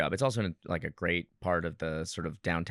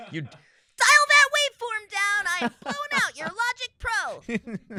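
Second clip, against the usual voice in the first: very high squeaky cartoon voice, slow; helium voice; 7 dB louder than usual. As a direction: talk like you sucked helium. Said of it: "full on sucking helium".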